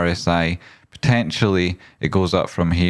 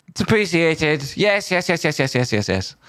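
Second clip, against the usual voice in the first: high falsetto, sliding down through repeated words; put-on high voice of gratitude; silly high acknowledgement that gradually settles; mock gushing thanks; 10 semitones higher than usual.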